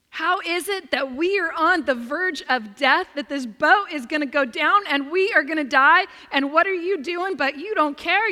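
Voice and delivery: loud, urgent outcry of frightened disciples; panicked disciples. Retold or imitated. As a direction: shout it as if the boat is sinking. Disciples panicking, accusing sleeping Jesus.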